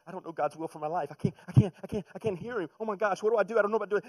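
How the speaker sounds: high, loud frantic mock panic; panicked believer. Preacher mocks believers panicking over God's will, high and loud. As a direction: play a flustered believer losing it. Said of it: "we sit here and we go".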